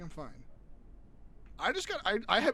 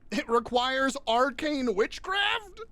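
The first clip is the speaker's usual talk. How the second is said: excited; 10 semitones higher, 8 dB louder, much slower than usual. Real, not acted. Reading a spooky item recipe.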